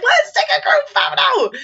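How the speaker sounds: very high, chirpy teacher imitation; hammed-up perky squeal; mock cheeriness. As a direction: announce it with over-the-top fake cheer. Teachers cheerily announce photo to kids.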